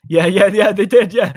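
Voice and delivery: loud, mocking yeah yeah yeah; sarcastic agreement. Real, not acted. Sarcastic agreement about the game.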